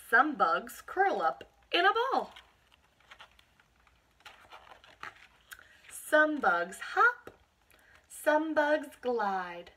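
slow, loud, punchy read-aloud; storybook read-aloud voice, every word punched; reading to children. Acted. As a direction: read each line slowly with big emphasis for little kids. Reads picture book to children.

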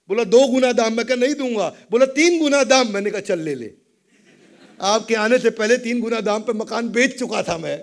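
ordinary talk, nothing marked

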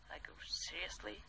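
disbelieving whispered "seriously?"; whispered incredulity; incredulous whisper. Real, not acted. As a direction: breathe it out in disbelief.

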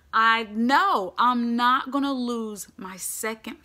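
loud, drawn-out fatherly outrage; acted upset dad laying blame; indignant refusal. Acted father refusing to send son.

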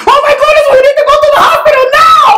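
high, loud, fast alarmed cry; panicked mom. Retold or imitated. Puerto Rican mom rushing her son to hospital. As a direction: hurry like a frantic mother. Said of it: "Puerto Rican mom".

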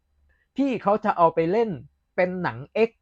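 high, slow imitated southern Thai; southern Thai accent. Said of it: "สำเนียงอย่างเนี้ย".

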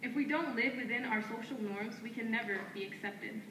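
firm, declaiming poetry lines traded back and forth; spoken word duet. Acted. Two poets trading lines on stage, in a firm declaiming delivery. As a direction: answer your partner line by line like a chant.

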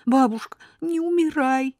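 high, tearful begging; wife pleads with dying grandmother, crying. Acted. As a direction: beg her not to die through tears. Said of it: "держала на коленях голову бабушки и плакала".

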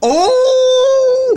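long, high, loud gasping "oh"; gleeful "ooh". Real, not acted. Reacting to the princess's letter, loud gleeful "oh". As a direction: let out a drawn-out high whoop.

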